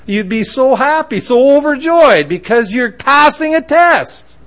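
high, stretched-out, loud punches; overjoyed emphasis. Real, not acted. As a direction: preach it with mounting delight. Preacher building to joyful point.

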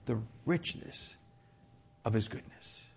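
"richness" slowed right down, hushed; emphasis on richness. Shown as a demonstration. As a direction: dwell softly on the word "richness". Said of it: "I didn't emphasize that word".

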